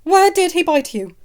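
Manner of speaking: high, loud, demanding; fierce. Acted. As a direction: fire it at him fiercely. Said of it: "she asked fiercely".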